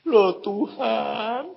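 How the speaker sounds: very slow, drawn-out lament; bewildered blind man. Retold or imitated. Man who thinks he is blind to God, slow plaintive drawl. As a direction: wail it mildly, stretching the last word. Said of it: "Dia ngomong gini".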